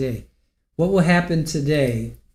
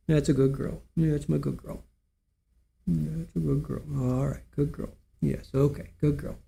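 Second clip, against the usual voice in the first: softer, gentle praise to the pet; soft dog praise; 9 dB quieter than usual. Real, not acted. Owner softly praising her dog Super Ball, tender murmur.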